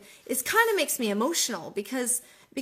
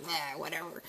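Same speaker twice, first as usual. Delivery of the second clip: low, much softer throwaway "nah, whatever"; dismissive shrug; 6 semitones lower, 9 dB quieter than usual. Retold or imitated. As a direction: brush it off under your breath. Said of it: "and I'm just like".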